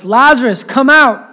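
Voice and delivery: a loud call pitched high, reaching into the tomb. A narrator's reading. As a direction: call him out of the grave. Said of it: "called in a loud voice".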